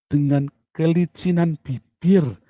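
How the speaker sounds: mock the smooth talker's slippery lips; sly, stretched delivery of the smooth lips; slow mock slickness. Shown as a demonstration.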